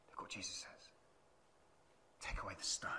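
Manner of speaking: hushed, breathy whisper. Acted. Preacher whispering Jesus' command to the church. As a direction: whisper it like a secret.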